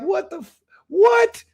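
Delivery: shrill, rising shocked squeak; stunned disbelief. Retold or imitated. Him hearing the friend's news about the warrant, squeaking in shock. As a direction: voice cracks upward in total disbelief.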